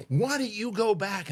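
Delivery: high, slow mock indignation; angry woman's jab. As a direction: imitate a mad driver, drawing the question out. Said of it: "looks at me, she goes".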